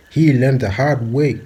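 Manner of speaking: declared loud like a final verdict; loud emphatic warning. Real, not acted. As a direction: hammer the warning home, slowly. Warning about [name].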